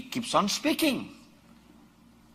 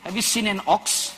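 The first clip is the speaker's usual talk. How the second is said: ordinary delivery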